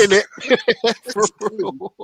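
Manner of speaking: words squeezed out between high laughs; laughing. Real, not acted. Laughing at the Tesco joke.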